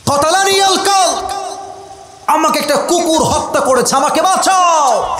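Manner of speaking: very high, desperate acted shout; acted scream. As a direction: scream for rescue after being stabbed. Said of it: "চিৎকার দিয়ে বলছেন". (আর্তচিৎকারের অভিনয়)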